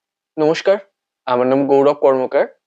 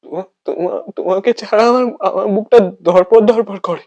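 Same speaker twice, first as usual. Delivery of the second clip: pained confession; 7 semitones higher, 7 dB louder than usual. Acted. Acted confession of heartache.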